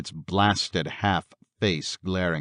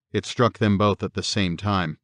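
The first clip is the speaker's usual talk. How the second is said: plain reading voice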